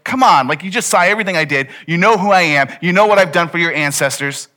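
loud, exasperated scolding; angry. Acted. God angry at Moses for refusing, loud exasperated anger. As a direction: raise your voice in indignant reproach. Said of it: "And God's angry".

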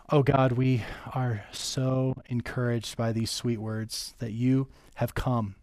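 prayer. Acted. Pastor leads closing prayer.

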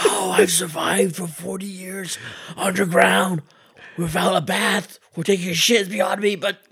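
high, slow croaking tethered voice. Pretend. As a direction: rasp it like the creepy double. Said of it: "I am Tommy Tether".

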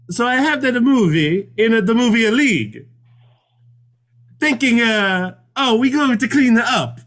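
high-pitched, hammed-up Italian accent; cartoonish Italian movie talk; mock Italian accent. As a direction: put on a playful Italian accent. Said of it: "do so in an Italian accent".

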